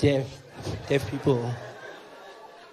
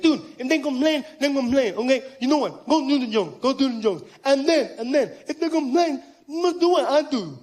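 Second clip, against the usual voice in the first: deaf man's speech; 7 semitones higher, 10 dB louder than usual. Retold or imitated. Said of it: "He's like".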